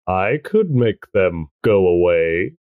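low, slow voice from the pit; sinister tempting voice. Acted. Voice from the pit tempting Penny, low and slow. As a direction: offer it slowly and low, temptingly.

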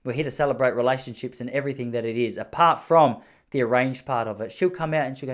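usual speaking voice

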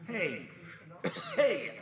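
high, loud mocking call; jeering taunt. Patient to Martha, loudly jeering at the devil. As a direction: rouse the crowd against him.

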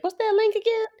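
mock high curious voice; high asking voice. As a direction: squeak it like a confused fan. Mimicking a listener on Instagram.

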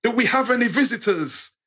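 a hint of a preacher addressing the congregation; preacher's call. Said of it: "the preacher's at the front and goes".

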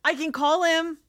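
high, rushed, panicking; panicked. Acted. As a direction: beg to help in a panic. Panicked offer to help.

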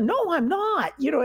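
high, quick protesting blurt; burst out. Retold or imitated. Retelling how she protested her Russian introduction, high blurt. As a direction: blurt it out in mock alarm. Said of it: "I can't help but burst out".

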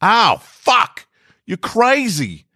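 high, sharp cry of pain then outrage; pained yelp. Acted. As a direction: yell it as you hit the dashboard. Passenger slammed into dashboard.

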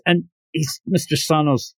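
ordinary delivery, nothing marked

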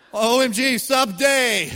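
laughing out loud, high and loud. Real, not acted. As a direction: burst out laughing at the typo. Laughing at autocorrect typo.

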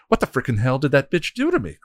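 high, loud furious yell. Acted. Shaken hell god at her minions, yelling. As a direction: rage at whoever hurt you. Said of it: "Glory yelled".

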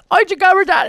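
put-on high-pitched, almost country accent; weird country accent. Bad dude grabbed by Batman, mock country accent. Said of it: "weird kind of almost country accent".

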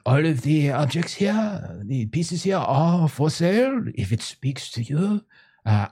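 put-on high, eager French accent; French merchant. Eccentric French artist welcoming a visitor into his tent, high eager French accent. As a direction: fawn over the customer in a thick French accent.

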